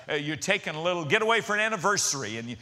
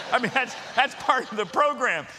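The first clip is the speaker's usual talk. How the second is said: ordinary talk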